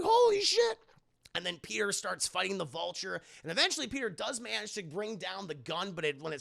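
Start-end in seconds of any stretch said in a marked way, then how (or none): 0.0-0.8 very high, loud alarm; acted panicky squeal; shocked exclamation. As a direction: cry out in mock horror. Peter sees agents blasted.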